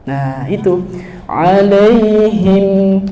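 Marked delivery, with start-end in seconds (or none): 1.3-3.0 'Alaihim with the final m held just briefly; right length ending. Shown as a demonstration. Teacher showing students the correct ending, held briefly. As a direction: stop the m of 'Alaihim after a short hold. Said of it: "Cukup deh segitu aja".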